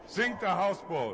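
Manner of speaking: loud barked captain's command of "Sink"; shouted order. Acted. Captain shouts to his crew, a loud barked command. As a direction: yell it to the crew like a ship's captain.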